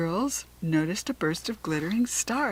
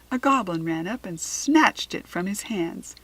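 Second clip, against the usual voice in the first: plain reading voice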